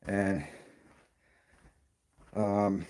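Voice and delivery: short of breath while talking; winded, catching breath between words. Real, not acted. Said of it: "let me catch my breath".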